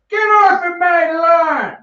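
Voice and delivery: put-on old man yelling at kids on bikes, cranky and high; grumpy old man yelling. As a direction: shake your fist at the kids on your lawn.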